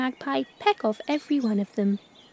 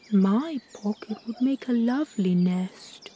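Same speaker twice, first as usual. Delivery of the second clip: ordinary delivery, nothing marked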